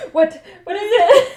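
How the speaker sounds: high, loud panicked question; hammed-up jolted mom; startled awake. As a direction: snap awake in mock alarm.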